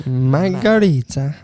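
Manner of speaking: slow, drawn-out mock Italian; Italian accent. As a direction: stretch it like a cartoon Italian. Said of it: "Do Italian".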